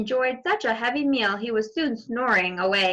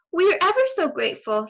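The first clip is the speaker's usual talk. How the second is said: higher, fast gushing thanks; quick little girl thanks; 8 semitones higher, much faster than usual. Acted. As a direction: gush thanks to the hunter.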